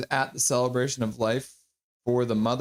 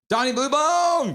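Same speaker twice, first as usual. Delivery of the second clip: hype shout; 10 semitones higher, 4 dB louder than usual. Acted. Streamer cheering out a chat message, hype shout. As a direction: belt it out with a long last word.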